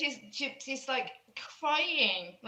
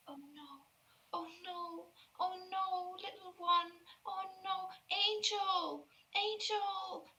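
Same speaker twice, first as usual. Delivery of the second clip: higher, soft, whimpering oh no; a child breaking down in grief; despairing girl; 9 semitones higher, 8 dB quieter, slower than usual. Acted. Girl despairs, begins sobbing.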